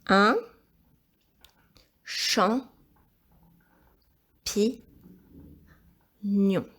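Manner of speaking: measured, isolated syllables with long pauses; syllable-by-syllable dictation. Shown as a demonstration. Teacher repeating champignon for pupil writing, syllable-by-syllable dictation. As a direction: leave time to write after each syllable.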